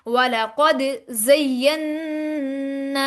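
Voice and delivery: slow वलकद ज़य्यन्ना with a long hummed न; ज़य्यन्ना drawn out on a nasal hum; ghunna demo. Shown as a demonstration. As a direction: bounce the द, then hold the न of ज़य्यन्ना in the nose. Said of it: "यहाँ पर गुन्ना करते हैं".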